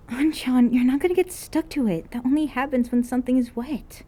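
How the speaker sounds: high, light and patient; sweet girl voice. Acted. Kohane gently corrects An.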